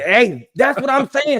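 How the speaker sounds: high loud excited shout; slow, hyped shout. Real, not acted. Host hyped about draft memory.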